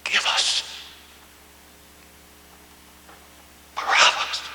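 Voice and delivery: hushed, breathy whisper of Give us Barabbas; whispered echo. Acted. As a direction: let the words fall to a haunted whisper. Whispered echo of crowd's cry.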